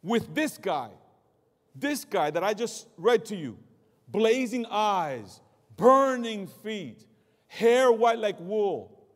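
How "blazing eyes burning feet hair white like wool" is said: louder, slow, each phrase hammered; emphatic slow build. Real, not acted. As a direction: paint the terror word by word. Preacher builds description.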